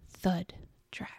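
Thud and Drag whispered softly. Shown as a demonstration. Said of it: "How can I make that more chilling?".

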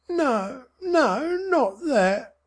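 high, crying mother; horrified mother. A narrator's reading. Mother pleading with husband, horrified and crying. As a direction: beg him to deny it.

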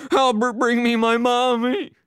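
very high, loud wailing plea; hysterical crying shout. A narrator's reading. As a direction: bawl it out uncontrollably. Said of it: "crying uncontrollably ... he cried".

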